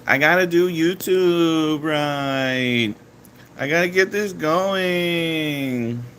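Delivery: high, slow, drawn-out voice; mock earnest vlogger. To his viewers, teasing himself, high slow mock-vlogger voice. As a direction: lampoon himself as a try-hard YouTuber, high and slow. Mocking himself about YouTube.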